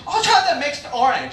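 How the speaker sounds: cheerfully clueless customer impression. Imitates the customer.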